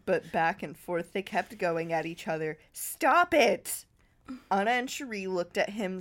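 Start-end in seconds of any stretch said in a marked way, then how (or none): 2.7-3.8 louder, higher sharp command; raised voice. Acted. Ray yells at the quarrelling girls to stop. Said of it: "raise his voice like that".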